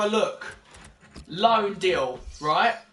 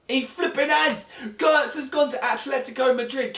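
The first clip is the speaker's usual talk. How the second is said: real disbelief; 5 semitones higher than usual. Real, not acted. Goetze transfer surprise.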